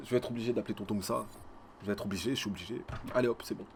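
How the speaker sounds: fast muttered aside. Real, not acted. Aside before calling tonton.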